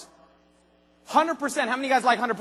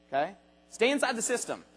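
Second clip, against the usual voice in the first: normal talking voice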